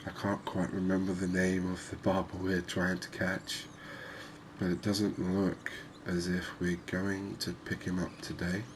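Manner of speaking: ordinary talk, nothing marked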